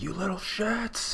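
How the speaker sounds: hammed-up furious insult; acted outrage. Acting out angry character's insult.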